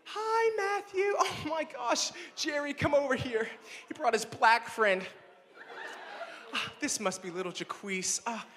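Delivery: high, gushing mom voice. Retold or imitated. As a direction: squeal like a fussing suburban mom. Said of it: "and I'm like".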